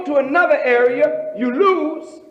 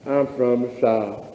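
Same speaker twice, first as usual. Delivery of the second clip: low, slow Southern drawl; 13 semitones lower, 4 dB quieter than usual. Shown as a demonstration. A Southern visitor complaining, in a low drawl. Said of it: "a brogue".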